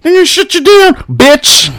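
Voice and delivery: very high squeaky mock voice. Mocking haters threatening his podcast, very high and squeaky. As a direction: squeal it like a tattletale. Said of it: "People are like".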